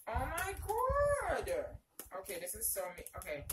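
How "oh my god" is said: high, loud, stretched-out squeal; real long squeal of delight; gushing. Real, not acted. Reacting to another baby gift.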